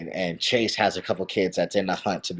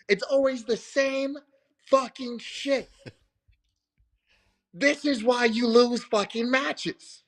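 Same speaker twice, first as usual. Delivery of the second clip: much higher and louder, furious berating rant; coach yelling at kid; 13 semitones higher, 4 dB louder than usual. Retold or imitated. Coach screaming at his wrestler after a loss, furious. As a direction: tear into the kid like an enraged coach. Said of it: "he goes to his kid".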